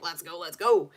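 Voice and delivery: a hint of the little dragon voice, eager; impatient dragon. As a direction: push it out like a restless dragon. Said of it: "but my dragon is like".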